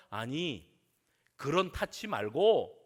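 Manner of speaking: usual speaking voice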